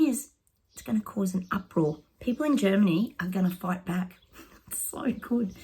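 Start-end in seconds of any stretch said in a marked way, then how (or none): 4.7-5.5 breathy whispered delight, half laughing; a secret little thrill, barely voiced; gleeful whisper. Real, not acted. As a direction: whisper it to yourself, savouring it. Gleeful aside about Germans fighting.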